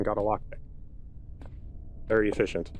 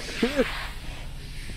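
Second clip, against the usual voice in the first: nervous laugh; 4 dB quieter than usual. Real, not acted. Nervous after a scare.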